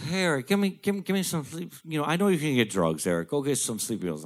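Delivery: high pleading dad voice; imitating begging father. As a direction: wheedle like the old man.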